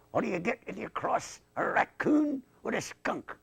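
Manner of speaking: high-pitched puppet voice; riddling dummy. Acted. Dummy tells the man a riddle, high puppet voice. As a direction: pose it in a high comic squeak.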